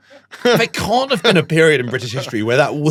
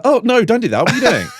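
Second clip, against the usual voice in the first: higher, mock-horrified "oh no"; retelling his startled protest, pitched up; alarmed; 8 semitones higher, faster than usual. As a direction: recoil in mock horror. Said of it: "But I really did like a".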